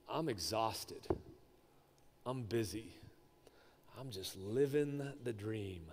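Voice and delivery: low, soft, resigned confession; worn-out. Acted. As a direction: drag it out quietly, all energy spent. Acting out a worn-out year's end.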